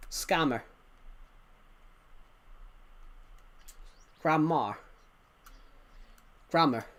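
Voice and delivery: Scammer, Grandma, Grammar each with an r-coloured final a; accent demo. Shown as a demonstration. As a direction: let every final a slide toward an r. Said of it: "the A adds a bit of an error onto the".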